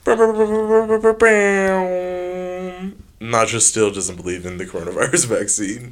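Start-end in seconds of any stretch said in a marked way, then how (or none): 0.0-2.9 high drawn-out mouth siren wail; siren noise. Pretend. Mouth siren after the sneeze, giving the editor the siren sound he asked for. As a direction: imitate a police siren with your voice. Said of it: "somebody edit sirens in".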